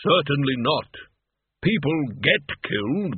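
deliberate, slow-starting, indignant Death; affronted Death. Acted. Death defending his trade to Mort, offended and deliberate. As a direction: put the boy right with weighty dignity.

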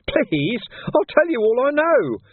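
high squeaking beg. Acted. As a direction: squeak out the plea in panic. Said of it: "Ferret squeaked".

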